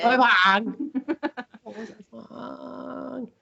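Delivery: high-pitched mock Chinese accent with laughter. Shown as a demonstration. Host showing different ways to say it, mock Chinese accent. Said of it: "you have to say it in an".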